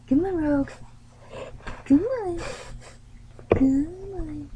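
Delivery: high, loud sing-song call; coaxing a dog. Real, not acted. Owner calling Rogue to come, high loud coaxing. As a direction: urge the pet along, high and lilting.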